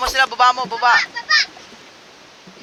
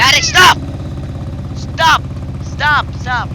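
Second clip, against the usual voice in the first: loud yell that fades over the repeats; yelling stop; 8 semitones higher, 3 dB louder, slower than usual. Real, not acted. Gamer yelling at a friend to stop in the game. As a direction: shout at him to quit it, then give up.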